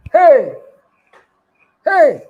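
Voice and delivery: loud, high whoop of amazement; exclaiming. Retold or imitated. Retells own reaction to begging client.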